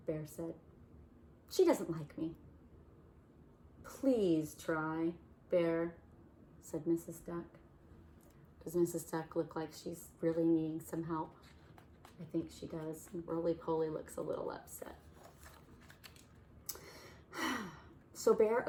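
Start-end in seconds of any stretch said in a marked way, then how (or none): none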